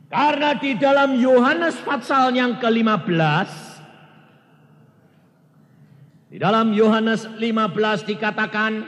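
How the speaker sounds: loud, high scripture proclamation; preaching at full force. Acted. Preacher quoting Jesus to the congregation, loud. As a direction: proclaim the word to the hall.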